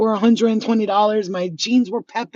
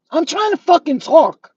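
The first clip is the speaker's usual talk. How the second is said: louder and higher, slow and furious; angry outburst; 6 semitones higher, 4 dB louder than usual. Real, not acted. Woman ranting on her channel, snapping at an interruption, angry. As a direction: snap at whoever keeps bugging you.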